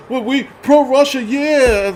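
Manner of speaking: high, dopey mock voice; mocking pro-Russia viewers. Ridiculing pro-Russia preppers in his comments, high dopey mock voice. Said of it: "you guys with the fucking whole".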